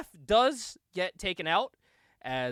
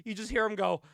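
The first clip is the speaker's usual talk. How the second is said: usual speaking voice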